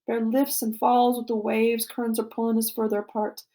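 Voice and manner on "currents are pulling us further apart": plain reading voice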